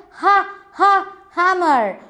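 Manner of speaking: "Ha, ha" breathy H stressed before "hammer"; h sound stressed. Shown as a demonstration. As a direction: split off the first sound "Ha" then say "hammer". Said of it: "what is the sound of the starting word".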